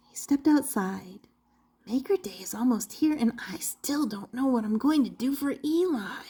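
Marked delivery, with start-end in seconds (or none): 1.9-6.3 soft, fast worried whisper; whispering to himself. Acted. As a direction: mutter a worry to yourself.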